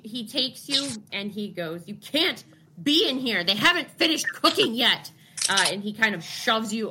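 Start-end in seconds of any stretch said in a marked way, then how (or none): 1.9-5.1 angry. Acted. GM voices NPC warning.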